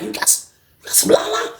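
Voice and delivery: soft unvoiced whisper; whispered ask. Cellmate asking for the Lala, in a hushed whisper. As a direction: keep it hushed so no one hears.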